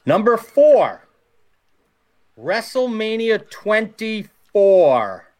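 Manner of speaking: countdown announcer. Acted. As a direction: build it up like a big reveal. Host announces the countdown entry.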